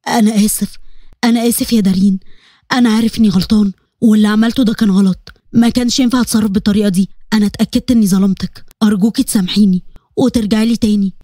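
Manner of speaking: a lightly voiced man begging forgiveness; remorseful husband. A narrator's reading.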